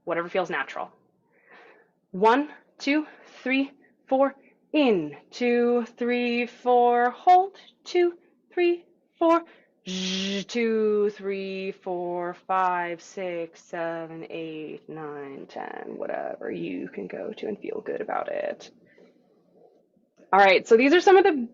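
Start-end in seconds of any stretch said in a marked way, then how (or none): 2.1-9.4 steady, evenly spaced exercise count; rhythmic instructor counting; metered count-in. Shown as a demonstration. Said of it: "I'm gonna count you in with four". 9.9-18.7 droning count that fades away to a breath; steady, sinking, softening count; long exhale count. Shown as a demonstration. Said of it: "then out as long as you can".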